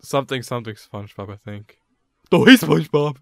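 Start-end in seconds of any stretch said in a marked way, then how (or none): 2.3-3.2 louder, a lightly voiced cartoon starfish; Patrick Star impression. Retelling a friend's car impression, loud goofy cartoon voice. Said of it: "impersonating Patrick Star".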